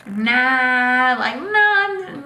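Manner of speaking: loud, drawn-out mock refusal; disbelieving friend.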